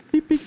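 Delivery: high playful beep noises; squeaky mock horn beeps. Imitating a vehicle horn.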